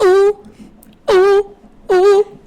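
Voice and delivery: very high sung ooh. Pretend. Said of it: "more like [name]".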